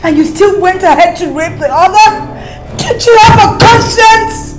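high, loud outraged accusation; angry. Acted. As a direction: hurl it at him, outraged. Wife confronts husband.

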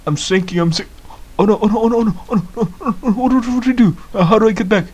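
high, rapid-fire flustered panic; panicked. Real, not acted. Player sinking underwater and unsure how to get back, panicked. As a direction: let the words tumble out high in alarm.

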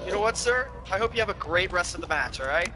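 polite robot voice, stiff and even. Pretend. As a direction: talk like a courteous AI robot. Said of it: "sounds like he's an A I robot".

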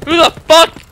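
short, high, loud outburst; indignant shout. Real, not acted. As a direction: shout it in angry disbelief. Gamer outraged by the kill.

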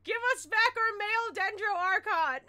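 hammed-up loud outcry; mock protest demand. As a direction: shout it like a protest sign. Mock protest chant at meme.

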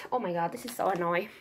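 ordinary talk, nothing marked

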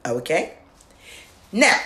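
ordinary delivery

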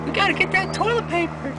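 very high falsetto mock girlfriend; squeaky put-on girlfriend voice; girlfriend's voice. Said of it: "she was like".

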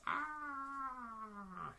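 high, drawn-out "ah" scream. A narrator's reading. Storyteller acts out Missus Wishy-Washy's shock, high scream. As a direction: let out a long high cry. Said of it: "she screams".

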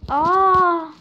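loud, high "aah" cry; sudden drawn-out yell; startled yell. Real, not acted. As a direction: cry out as the jump goes wrong. Startled yell during play.

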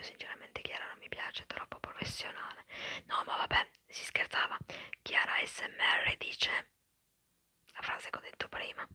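ordinary talk

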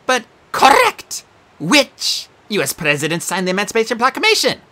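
high prim quizmaster creature; Sphinx voice. Acted. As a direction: play a fussy exam monster.

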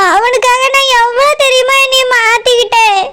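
usual speaking voice

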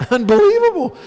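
high, loud exclamation; aghast disbelief. Retold or imitated. Comic appalled at calling him pal, high disbelief. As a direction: cry it out in hammed-up disbelief. Aghast at his own blunder.

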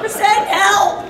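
loud laughing plea; real laughter, can barely speak; laughing hard. Real, not acted. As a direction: crack up and beg for help. Laughing at the absurd scene.

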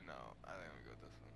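whispered under the breath; barely a whisper, no voice. Real, not acted. Quietly choosing a character.

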